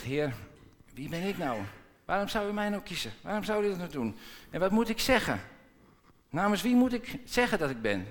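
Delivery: high, anxious pleading with God; Moses, high and pleading, doubting himself. Acted. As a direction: whine your objections upward.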